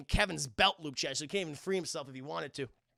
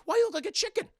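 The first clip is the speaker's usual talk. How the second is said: high-pitched, loud mock question; baffled retort; 7 dB louder, faster than usual. Burglar to his feathered partner, baffled high retort. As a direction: fire back in comic disbelief.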